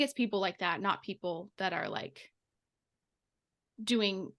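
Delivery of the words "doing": usual speaking voice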